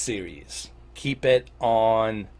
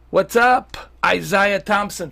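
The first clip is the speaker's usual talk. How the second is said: excited greeting; 9 semitones higher, 9 dB louder, slower than usual. Real, not acted. Greeting viewer in chat loudly.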